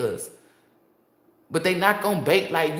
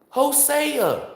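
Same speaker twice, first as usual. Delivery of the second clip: higher, louder proclaimed name; calling out; 9 semitones higher, 4 dB louder than usual. Real, not acted. Hyping up Hosea, loud call-out. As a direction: announce the name proudly.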